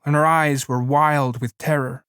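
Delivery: ordinary delivery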